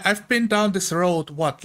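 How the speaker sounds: normal talking voice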